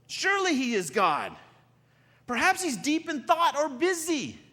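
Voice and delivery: loud, high taunting shout; yelling out. Acted. Elijah poking fun at Baal's prophets, yelling out. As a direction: call it out mockingly across the crowd. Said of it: "and he yells out".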